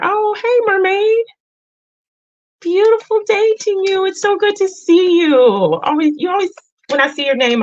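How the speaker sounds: light up and squeal a hello; excited high greeting. Real, not acted. Greeting regular viewer Mermaid in chat, excited and high.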